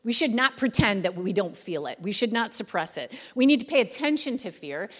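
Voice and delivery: normal talking voice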